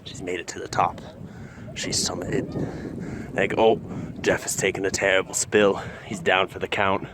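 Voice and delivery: hushed, low wildlife narration; nature documentary narrator. Acted. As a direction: murmur like a nature film host.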